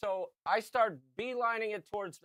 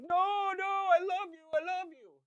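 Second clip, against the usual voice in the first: put-on pleading kid; 12 semitones higher than usual. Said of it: "He's like".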